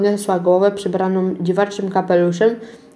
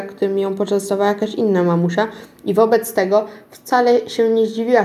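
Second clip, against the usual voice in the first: usual speaking voice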